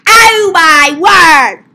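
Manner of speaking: slow, loud, drawn-out exclamation; exasperated yell. Pretend. Driver reacting to bad traffic, loudly exasperated.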